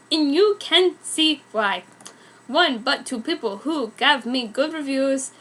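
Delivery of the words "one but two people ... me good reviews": ordinary delivery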